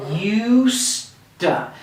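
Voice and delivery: "used to." with used stressed and to weak. Shown as a demonstration. Teacher shows where the stress goes. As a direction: lean on "used" and let "to." fall away. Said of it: "we're gonna emphasize the first one".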